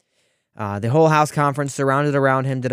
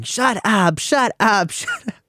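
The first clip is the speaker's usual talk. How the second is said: higher-pitched imitation of yelling; mock yelling; 9 semitones higher, slower than usual. Lawmaker heckling a reporter at a press conference, mock yelling. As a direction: mimic a heckler yelling over a question. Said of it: "just yelling".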